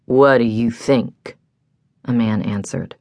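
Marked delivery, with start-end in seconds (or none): 0.1-1.4 lightly slurred, lazy man's voice; lazy slurring man. A narrator's reading. Man answering the angry woman, lazily slurred. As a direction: answer with a hint of a drunken slur. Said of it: "slurring his words from laziness".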